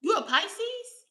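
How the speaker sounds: high, loud surprised question; real surprise, voice jumps high and loud. Real, not acted. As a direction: pop the guess up high in surprise. Guessing someone's star sign.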